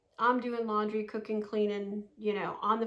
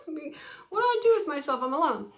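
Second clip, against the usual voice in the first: fast and louder through real laughter; laughing; 4 semitones higher, 4 dB louder, faster than usual. Real, not acted. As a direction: laugh through the question at yourself. Laughing at her own question.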